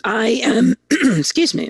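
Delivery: throat tickle, clearing it mid-sentence; catching on a tickle in the throat; frog in throat. Real, not acted. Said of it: "I've got a tickle in my throat".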